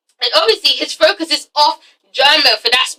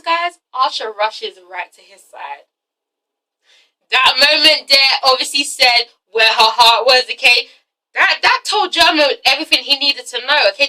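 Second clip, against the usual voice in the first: ordinary talk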